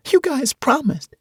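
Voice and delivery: put-on high, hurt girl's voice; upset young girl. Tasha reminding adults of their promise, high and hurt. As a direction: accuse them like a betrayed kid.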